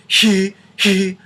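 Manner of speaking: airy h leading into he; breathy onset. Shown as a demonstration. Teacher demonstrating the wrong breathy onset, sung high. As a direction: let air leak before the tone. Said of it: "They're not blowing apart like".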